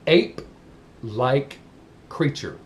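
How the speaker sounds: very slow, deliberately spaced words; slow and clear. Shown as a demonstration. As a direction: drum it in, one word at a time. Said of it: "I'm gonna say it slow and as clear as I can".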